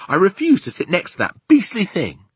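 loud, high-pitched objection; indignant cry. A narrator's reading. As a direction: protest loudly as an affronted child. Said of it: "cried Sophie".

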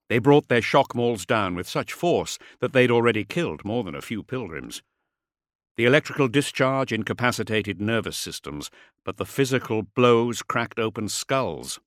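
ordinary delivery, nothing marked

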